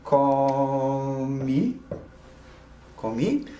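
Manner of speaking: comí with stress on the final í, ko-MEE; Spanish comí. Shown as a demonstration. Teacher modelling comí for the class. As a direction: model comí slowly, twice. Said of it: "we'll just take comer".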